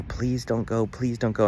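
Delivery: retelling a dream, pleading with mom not to go; mild retold begging. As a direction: beg her not to leave, lightly reliving it. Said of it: "I was begging her".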